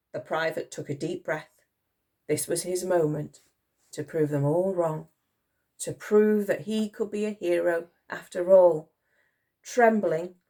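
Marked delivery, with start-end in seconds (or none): none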